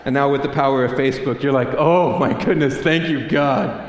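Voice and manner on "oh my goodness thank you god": big, loud, grateful exclamation; mock relief. Seeing an old love interest on Facebook, loudly mock-relieved. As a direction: thank God with exaggerated relief.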